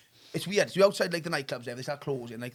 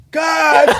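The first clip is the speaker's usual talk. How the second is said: mock shout; 11 semitones higher, 13 dB louder than usual. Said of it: "Comes back in and goes".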